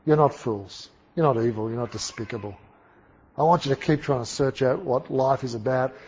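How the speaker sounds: quiet, measured address to students; calming reassurance. Retold or imitated. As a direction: settle them with a soft apology. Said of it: "I have to calm these guys down".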